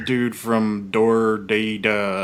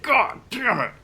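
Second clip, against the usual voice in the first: high-pitched mock exasperation; frustrated outburst; 14 semitones higher, slower than usual. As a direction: yelp it out in disbelief. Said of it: "I was like".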